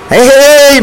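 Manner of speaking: calling out. Retold or imitated. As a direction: call them over from afar. Border guards calling out.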